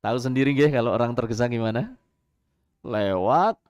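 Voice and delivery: ordinary talk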